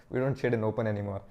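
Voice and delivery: usual speaking voice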